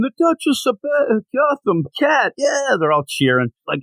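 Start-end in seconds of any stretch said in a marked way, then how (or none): 0.0-2.8 high, lightly hammed-up crowd chant; mock yell. Crowd yelling for Catwoman, imitated as a high mock chant. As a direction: chant the name like a rowdy crowd. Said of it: "They're yelling".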